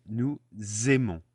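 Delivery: "nous aimons" with "aimons" starting on a clear Z; liaison Z. Shown as a demonstration. Teacher explaining the link to learners, "nous aimons" broken up with audible Z. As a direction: say "nous", pause, then "zay-mon" for "aimons". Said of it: "turn the S of nous into a Z".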